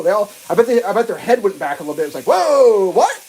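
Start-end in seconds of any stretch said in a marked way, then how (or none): 2.3-3.1 mock amazed whoa. Imagined dictators' amazement.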